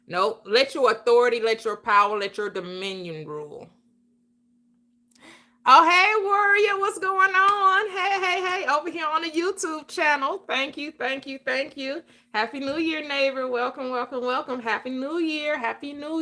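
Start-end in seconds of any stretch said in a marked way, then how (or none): 5.7-9.9 excited greeting. Real, not acted. Greeting a live chat viewer.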